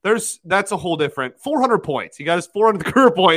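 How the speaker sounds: excited. Real, not acted. Celebrating the milestone.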